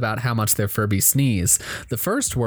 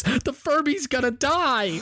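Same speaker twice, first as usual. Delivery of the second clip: put-on child's scream; 13 semitones higher than usual. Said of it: "[name] screams".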